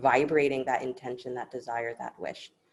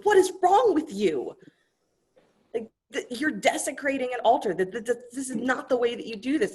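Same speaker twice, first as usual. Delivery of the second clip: scolding priest; 10 semitones higher, 4 dB louder than usual. Acted. Brahmin jumps out at the hunter, high furious scolding. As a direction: catch him in the act and let him have it. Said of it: "is like".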